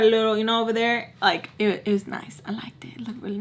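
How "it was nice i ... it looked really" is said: half-whispered, much softer comment; hushed aside. Real, not acted. Admiring the new piercing in a whispered aside. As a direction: drop into a whisper as an afterthought.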